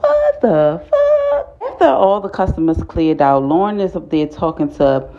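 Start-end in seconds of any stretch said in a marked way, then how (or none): 0.0-1.7 very high, slow, drawn-out shocked cry; stunned disbelief. Retold or imitated. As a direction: let it stretch out in pure disbelief. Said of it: "I'm like".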